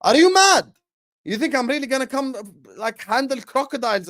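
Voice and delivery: high, loud, incredulous outburst; retelling his reply to friends, with mock incredulous outrage. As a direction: act appalled at the idea. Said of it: "I'm like".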